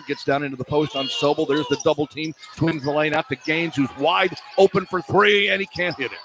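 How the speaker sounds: usual speaking voice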